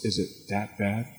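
hushed, worried passenger question; frightened woman. Retold or imitated. Woman on a sinking liner asking the captain, hushed worry. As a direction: breathe it out softly, worried. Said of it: "And the woman says".

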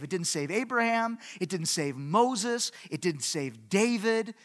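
ordinary delivery

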